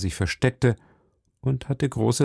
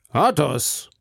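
wiederholter Ausruf; 5 semitones higher than usual. A narrator's reading. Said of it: "wiederholte Herr von Treville".

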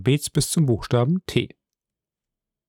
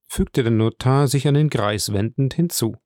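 plain reading voice

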